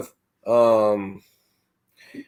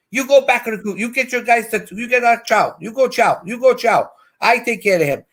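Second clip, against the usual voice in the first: imitating the small Filipino officer, pitched higher; little Filipino officer; 8 semitones higher than usual. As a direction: bark orders as the little officer, high voice. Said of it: "were little Filipinos".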